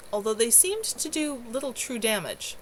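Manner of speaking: ordinary delivery, nothing marked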